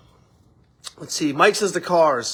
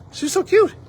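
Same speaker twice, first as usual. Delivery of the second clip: high gushing; 12 semitones higher, 4 dB louder than usual. Real, not acted. Gushing about the daughter.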